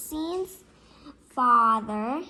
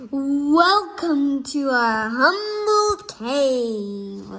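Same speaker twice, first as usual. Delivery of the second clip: mock grand welcome; 2 semitones higher, 7 dB louder, much slower than usual. A narrator's reading. Imagined caveman-like dinner welcome.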